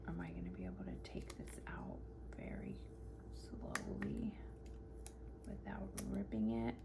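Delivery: soft, breathy concentration whisper; hushed whisper to herself. Real, not acted. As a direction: whisper while carefully peeling something. Careful peeling of a sticker.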